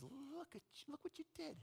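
a devil hissing shame into your ear; hushed Satan. Acted. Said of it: "And he says".

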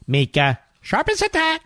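high, sneering imitation of a TV host; mocking high voice. Mocking a TV host, high and sneering. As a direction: squeak it out to ridicule her. Mimicking the guest's praise.

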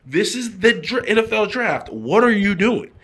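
frustrated agent. Retold or imitated. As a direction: scold in disbelief, imitating the agent. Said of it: "so he's frustrated".

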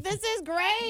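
high squeal of a delighted mom; imitating an excited mom. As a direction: gush like a thrilled mother who loves it. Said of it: "My mom would be like".

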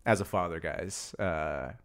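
low, soft mock-serious dad; gently pontificate as a father; lightly voiced earnest dad, low and hushed; fatherly authority. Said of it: "being a father is saying".

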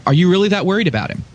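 ordinary delivery

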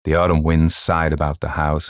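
usual speaking voice

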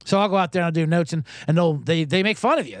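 normal talking voice

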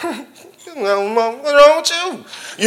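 high, very slow teasing echo; playful banter. Retold or imitated. Bantering back at the nurse, high slow teasing echo. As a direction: stretch it out, bouncing her question back at her. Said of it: "'cause I'm thinking we doing this".

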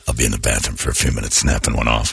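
low, quick put-on man's voice; mock heartthrob in a low register; George Clooney. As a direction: drop deep and casual, rattling it off. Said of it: "George Clooney looks at it and goes".

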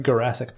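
Jurassic as guh-RASS-ic; hard G. Shown as a demonstration. Host teasing about the G problem, hard-G Jurassic. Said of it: "what if it's pronounced like".

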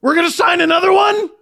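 high, loud disbelief; ranting in disbelief, laughing at the end; incredulous shouting. Real, not acted. Incredulous rant about contracts.

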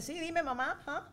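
put-on sarcastic child brushing mom off, high; imitating sassy daughter. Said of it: "Gail con los ojos te dice".

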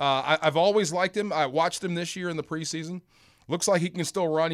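usual speaking voice

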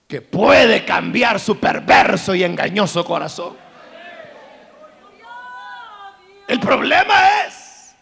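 loud, high sermon peak; preacher rising to an impassioned climax; preaching at full force. Real, not acted. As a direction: thunder it from the pulpit.

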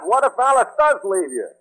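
ordinary talk, nothing marked

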